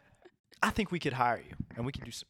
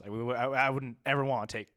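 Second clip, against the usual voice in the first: usual speaking voice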